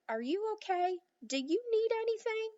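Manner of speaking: put-on a boy calling up to a tiny friend. A narrator's reading. Omri calls to Little Bear.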